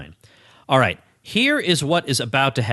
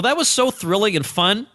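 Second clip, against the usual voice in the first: normal talking voice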